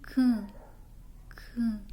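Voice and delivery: Q said as "can" with a nasal vowel; nasalized Q. Shown as a demonstration. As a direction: add a nasal "an" after the K sound. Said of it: "que é o an".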